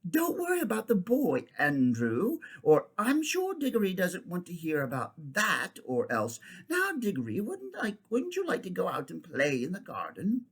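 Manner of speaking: lightly voiced fussy aunt; a hint of a bossy aunt; aunt shushing. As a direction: cut the uncle off sweetly. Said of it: "She says".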